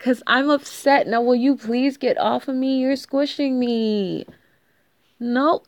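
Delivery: ordinary talk, nothing marked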